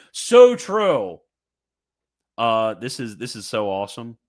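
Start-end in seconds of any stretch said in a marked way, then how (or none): 0.1-1.2 bursts out much higher and louder; a sudden delighted cheer; thrilled agreement shouted out; excited approval. Real, not acted. Reacting to papal quote.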